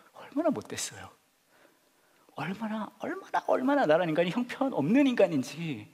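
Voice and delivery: anguished self-reproach. Real, not acted.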